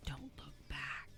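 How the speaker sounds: whispered slowly, like a secret. Retold or imitated. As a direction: breathe it as a hushed warning. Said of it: "on the back it says".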